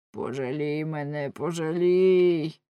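drawn-out plea for mercy; implore, stretching the last word long; pleading werewolf voice; begging werewolf. A narrator's reading. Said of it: "благав вовкулак".

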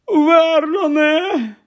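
very high, very slow drawn-out call; panicked cry. Acted. Aunt crying to her husband in panic, very high and slow. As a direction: cry his name, stretched out in panic. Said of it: "вона хапнула ротом повітря".

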